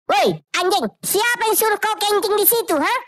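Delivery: high, loud scolding shout; angry yell. Acted. Security guard yelling at a man peeing, angry shout. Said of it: "langsung marah dan membentak orang itu".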